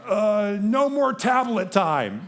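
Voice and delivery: loud, high, drawn-out mock dad outburst; frustrated yell. Dad punishing his laughing boys, acted frustrated yelling. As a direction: reenact an exasperated dad laying down punishment. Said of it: "out of my frustration".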